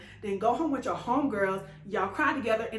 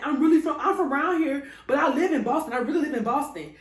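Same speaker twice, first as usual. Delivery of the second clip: insistent stranger; 5 semitones higher, 6 dB louder than usual. Retold or imitated. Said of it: "she kept saying that she's from Boston".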